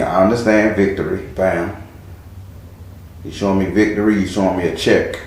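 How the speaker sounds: low, soft murmur to spirit; murmured channeling. Real, not acted. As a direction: mutter as if receiving visions. Channeling spirit, murmuring card words.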